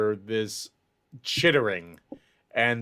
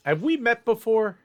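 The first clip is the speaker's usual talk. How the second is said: lightly voiced character, pitched much higher; questioning character; 11 semitones higher, 4 dB louder than usual. Game master's character questions a newcomer, high-pitched questioning. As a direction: ask it high, as if half recognizing someone. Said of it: "He looks at you and goes".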